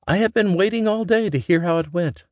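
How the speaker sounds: an eager mother waiting for news; mother eager to hear daughter's news; mother's voice. A narrator's reading. Mama voiced in story.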